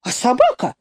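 understated indignant squeal; mild shriek. A narrator's reading. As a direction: squeal a protest, only half-heartedly. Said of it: "взвизгнула тётка".

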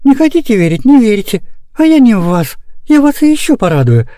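put-on high, animated older character; miffed nanny. A narrator's reading. Nanny to disbelieving children, high miffed voice. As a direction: scold lightly, then tease with good news. Nanny reproaches doubters, promises joy.

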